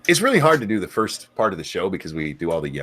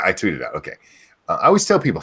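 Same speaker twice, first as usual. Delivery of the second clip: usual speaking voice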